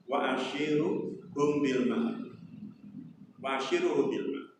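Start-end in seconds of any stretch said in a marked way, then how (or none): 0.1-2.3 slow, soft recited Arabic; Quranic recitation. Shown as a demonstration. Preacher quoting God's words to Ibrahim, slowly recited. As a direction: intone it like a verse being recited. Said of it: "Allah menyampaikan pada beliau".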